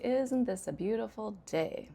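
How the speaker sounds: sarcastic tone, falling away on "day". Shown as a demonstration. Teacher using tone to complain indirectly, sarcastic. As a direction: say the nice words, mean the opposite. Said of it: "I can just use my tone".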